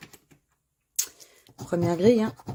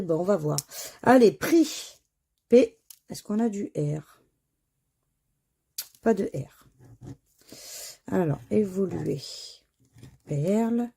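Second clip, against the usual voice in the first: normal talking voice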